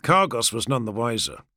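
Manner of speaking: ordinary delivery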